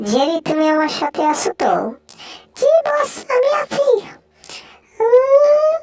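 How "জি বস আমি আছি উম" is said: high, excited cartoon mouse, squealing and fussing; squeaky mouse voice. Mouse finding food then scolding the sleepy cat, high squeaky voice. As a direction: play a tiny panicky mouse, high up.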